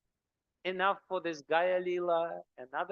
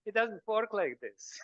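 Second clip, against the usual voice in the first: ordinary talk, nothing marked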